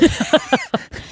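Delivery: high embarrassed laugh. Real, not acted. Laughing at himself.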